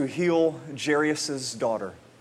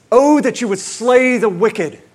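loud psalm cry; 6 semitones higher, 9 dB louder than usual. Retold or imitated. Quoting the psalm again.

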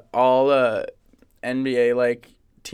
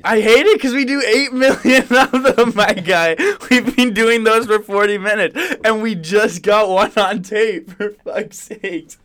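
exasperated; 7 semitones higher, 7 dB louder than usual. Real, not acted. Exasperated rant at cohost.

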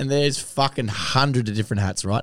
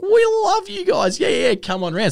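very high, gushing and slowed; gushing fan; 4 dB louder than usual. Retold or imitated. Gate woman welcoming the lads, high gushing. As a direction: gush over them like a fan. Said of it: "so she's like".

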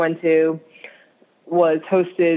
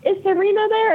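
high questioning voice. Retold or imitated. Retold quote from somebody.

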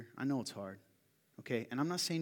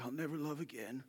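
mock pathetic; 8 dB quieter than usual. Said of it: "I literally said this sad, sad, pathetic thing".

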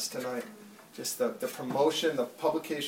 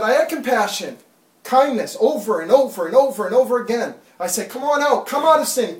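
high, loud, urgent call from God; impassioned plea; 5 semitones higher, 11 dB louder than usual. Acted. Preacher voicing God calling people out of sin, pleading loudly. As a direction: cry out come out over and over.

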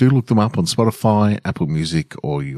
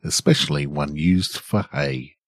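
story narration; 2 semitones lower than usual. A narrator's reading. Calm relaxing story reading.

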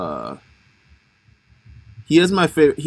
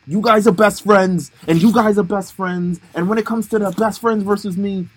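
higher, louder mock trainer accent; Rocky's-trainer accent; 7 semitones higher, 4 dB louder than usual. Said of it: "I don't know why I'm speaking like".

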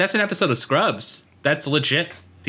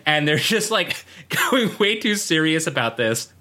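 ordinary delivery

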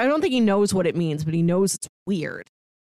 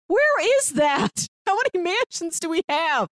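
mock puzzled where-is-that; incredulous; 9 semitones higher than usual. Real, not acted. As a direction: stretch it out up high in disbelief. Incredulous rhetorical question aside.

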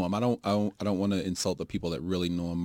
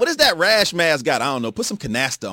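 mock yell; 10 semitones higher, 8 dB louder than usual. Mock shouting about Ma's rash.

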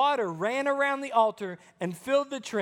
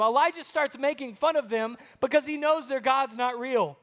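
ordinary talk

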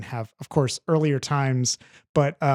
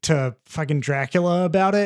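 usual speaking voice

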